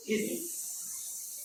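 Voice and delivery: long drawn-out hiss with no voice; stretched teacher's demo hiss; snake hiss. Shown as a demonstration. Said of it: "त्याच्या आवाजाला काय म्हणायचं".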